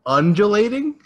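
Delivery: "undulating" high and loud, rising like a question; puzzled, amused lift on "undulating"; unsure questioning reading. Real, not acted. Unsure of a word, laughing.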